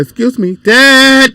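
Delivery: put-on high little-girl call rising on the last word; imitating young daughter. Father retells daughter interrupting his project, imitated child voice. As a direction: mimic a child demanding Dad's attention. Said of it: "She said".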